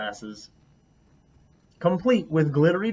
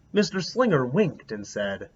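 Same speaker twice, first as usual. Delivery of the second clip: ordinary delivery, nothing marked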